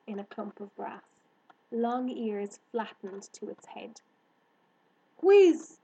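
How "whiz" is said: high, loud call of a name; sudden excited cry; cried out. Acted. Girl spots her hare friend, crying out. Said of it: "she cried".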